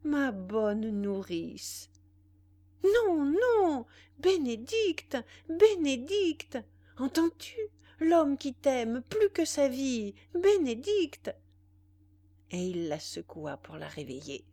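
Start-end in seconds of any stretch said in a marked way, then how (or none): 0.0-1.9 slow, drawn-out sleepy words; dreamy, half-asleep and very slow; drowsy. A narrator's reading. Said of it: "lui dit-elle en s'assoupissant sur son épaule". 2.8-11.3 loud, high, impassioned plea; slow, insistent shouting, frantic lover; desperate cry. A narrator's reading.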